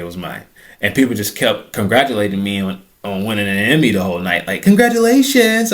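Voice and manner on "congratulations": high, loud mock congratulations; gushing partygoer. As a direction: gush it like an overexcited fan. Party guests congratulating him.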